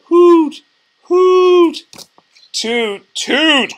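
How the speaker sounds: high, loud frightened toots; frightened hooting. Acted. Tugboat lost in the dark night, high frightened toots. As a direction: cry out like a scared little tugboat. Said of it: "cried the frightened tugboat".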